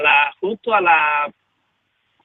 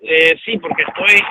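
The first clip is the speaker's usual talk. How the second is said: shouting over wind; 5 semitones higher, 7 dB louder than usual. Real, not acted. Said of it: "Y es el viento".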